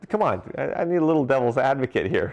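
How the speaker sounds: playfully begging someone to disagree; mock exasperated plea. Retelling how he begged friends for pushback, mock exasperated.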